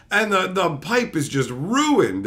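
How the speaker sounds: ordinary talk, nothing marked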